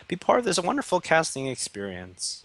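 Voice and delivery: high-pitched sarcastic enthusiasm. Real, not acted. Caster feigns delight at the casting gig, sarcastic. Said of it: "make it sound less sarcastic".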